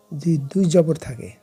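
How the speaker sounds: normal talking voice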